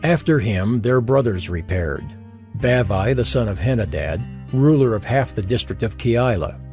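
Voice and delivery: ordinary talk, nothing marked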